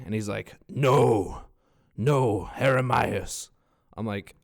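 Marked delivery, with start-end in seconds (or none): 0.7-3.5 loud, emphatic mock Spanish-speaking restaurant man; insistent correction. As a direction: correct the name forcefully, imitating him. Restaurant man correcting his name.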